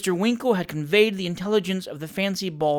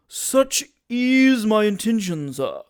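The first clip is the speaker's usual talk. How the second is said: slow, louder heated retort; warmly defensive, indignant; heated reply; 5 semitones higher, 4 dB louder, slower than usual. Acted. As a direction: bristle and answer slowly. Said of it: "replied Mister Tupman warmly".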